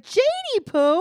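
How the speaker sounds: high, loud pet-name call; mock pleading boyfriend. Him calling to Jade after breaking glass, high mock pleading.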